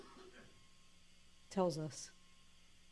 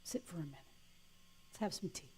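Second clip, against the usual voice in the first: much softer, gentle; soft invitation; 2 semitones lower, 11 dB quieter, faster than usual. Retold or imitated. Offering tea to the demon, softly. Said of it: "You go".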